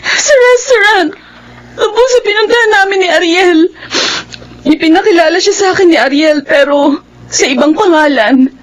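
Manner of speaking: high, crying voice, words breaking; tearful distress. Acted. Niece tells her aunt she saw her ex, crying. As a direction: fight through the sobs to get the words out.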